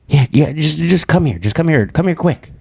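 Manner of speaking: high, insistent mock urgency; imitating urgent caller. Caller begging him home, urgent imitated voice. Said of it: "she was like".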